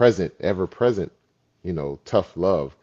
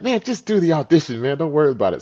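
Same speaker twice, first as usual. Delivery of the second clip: high, loud imitation of an urging buddy; insistent friend; 11 semitones higher, 7 dB louder, faster than usual. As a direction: wave away the worry, pitched way up. Retold friend brushing off the objection.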